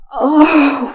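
loud breathy groaned "oh"; loud sighing groan; moan. Acted. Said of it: "we moan and groan".

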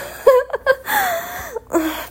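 high breathless laugh. Real, not acted. Laugh mid-sentence.

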